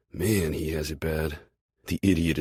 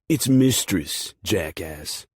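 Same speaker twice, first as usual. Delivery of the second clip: mock correction; 4 semitones higher, 6 dB louder, slower than usual. Correcting teammate, mock scolding.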